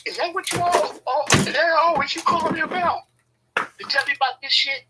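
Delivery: high shrill mocking voice of her; imitating her. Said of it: "she was like".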